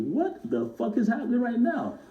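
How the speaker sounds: high, slow, amazed "what is happening"; stunned disbelief. Retold or imitated. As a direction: drag it out in wide-eyed disbelief. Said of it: "sitting there like".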